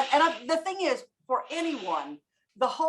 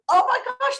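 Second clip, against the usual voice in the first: high, louder burst of delight; excited realisation; 13 semitones higher, 5 dB louder than usual. Retold or imitated. Trainer voices people starting to have fun, excited realisation. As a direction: exclaim it high, as a sudden discovery. Voicing learners' sudden realisation.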